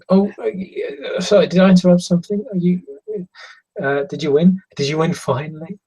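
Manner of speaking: put-on high, quick, eager girlish voice; young girl butting in apologetically; innocent, loving girl. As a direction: chirp questions like an eager granddaughter. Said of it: "she smiles at you innocently and lovingly".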